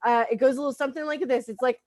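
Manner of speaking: usual speaking voice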